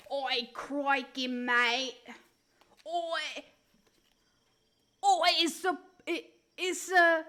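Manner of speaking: loud, hammed-up Aussie accent; broad mock Australian, stumbling as it goes. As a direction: call out like a cartoon Australian. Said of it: "See, I know Australian".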